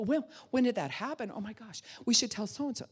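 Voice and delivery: high, flustered reaction; caught-up alarm. Acted. Listener going along with a traumatized friend, mock alarm. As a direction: get swept up in their panic, pitch jumping. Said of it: "you go with them".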